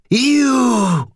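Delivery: high, loud venomous hiss. A narrator's reading. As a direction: spit the word out in hate. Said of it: "the ghost hissed".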